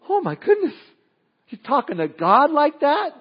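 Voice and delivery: high, loud gasp of disbelief; stunned, you can't believe your ears; shocked reader. Pretend. Reader reacting to Jonah's answer, shocked high disbelief. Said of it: "You read that and go".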